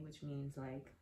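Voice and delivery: ordinary delivery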